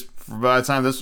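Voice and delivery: ordinary talk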